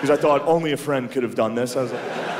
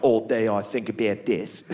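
mock Australian goth; 4 semitones lower, 3 dB quieter than usual. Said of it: "That guy can't go around like".

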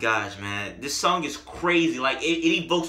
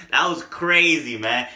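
usual speaking voice

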